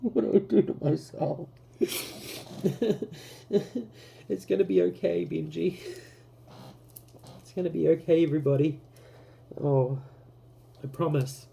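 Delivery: high, tearful half-laughing reassurance; teary laughing. Real, not acted. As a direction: laugh through tears while comforting yourself. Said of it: "because it made me cry".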